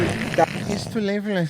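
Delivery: high, loud Cuban-accent mimicry; drawn-out, campy Cuban accent; mock Cuban accent. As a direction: echo it slowly in an exaggerated Cuban accent. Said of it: "the combination of the accent and the swishiness".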